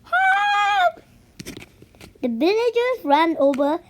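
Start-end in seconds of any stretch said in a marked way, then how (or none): 0.0-1.0 very high, loud shrieking scream; a terrified shriek, much higher; louder scream. Acted. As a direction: scream it at the top of her lungs. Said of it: "screamed even louder".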